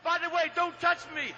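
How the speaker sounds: ordinary delivery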